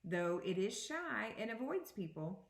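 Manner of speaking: usual speaking voice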